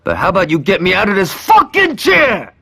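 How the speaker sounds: high, loud furious yell; angry shout. Acted. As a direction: bellow it at them, fed up with waiting. Tied up, demanding release.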